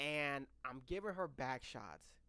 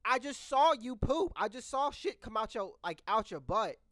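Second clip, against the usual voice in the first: higher and louder, pressing the point; insistent; 6 semitones higher, 5 dB louder than usual. Retold or imitated. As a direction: convince her he saw it. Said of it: "And I'm like".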